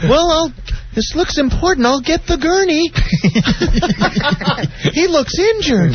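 high, jittery hammed-up voice; nervous acting voice. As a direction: play a nervous TV doctor. Hammed-up doctor bit, pitched up.

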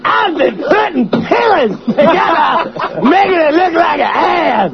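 high, hammed-up stand-up shouting; Chris Rock. Host confessing in the comic's style, Chris Rock impression. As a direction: rant in a comedian's high holler. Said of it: "That's Chris Rock".

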